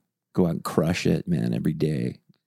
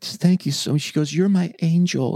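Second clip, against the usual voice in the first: put-on high sweet old lady; 9 semitones higher than usual. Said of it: "she's like".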